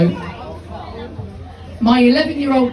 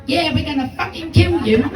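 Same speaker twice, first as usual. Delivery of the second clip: higher-pitched sidekick voice; echoing little guy; 5 semitones higher than usual. Retold or imitated. Little guy at the back echoing the bully, mock-threatening. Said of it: "Repeating everything the big guy says".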